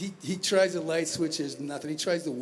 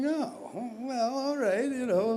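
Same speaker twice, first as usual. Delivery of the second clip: reluctant giving in; 6 semitones higher, slower than usual. Retold or imitated. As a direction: give in with a sigh-like lift. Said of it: "And I'm like".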